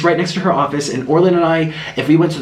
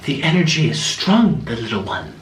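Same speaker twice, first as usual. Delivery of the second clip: mystic murmur; 4 dB quieter than usual. Retold or imitated. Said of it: "And then she goes like this".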